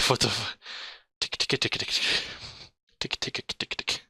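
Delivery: usual speaking voice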